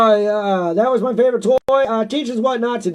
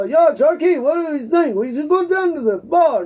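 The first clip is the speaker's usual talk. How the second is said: higher, botched German accent sliding into Italian; muddled German-Italian accent; German accent slipping Italian; 5 semitones higher than usual. Shown as a demonstration. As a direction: aim for German, drift into Italian. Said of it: "No, that's more Italian".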